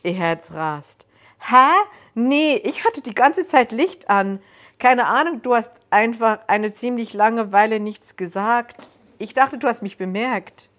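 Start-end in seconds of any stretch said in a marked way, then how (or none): 1.4-10.7 higher, louder, casual stranger; a relaxed, chatty man, voiced up high; the stranger's voice. Stranger surprised that Joan jumped, casual and higher.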